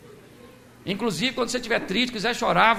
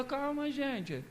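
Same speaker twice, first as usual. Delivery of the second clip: soft, slow and hushed; Jesus calming the weeping mourners, softly; calm soothing; 3 semitones higher, 16 dB quieter, much slower than usual. Acted. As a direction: settle the crying crowd gently. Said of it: "ele chegou e falou".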